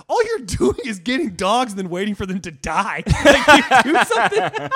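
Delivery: high, loud comic tirade; mock yelling that climbs into a shout; mock rant. Host roasting Nick about his dogs, mock outrage.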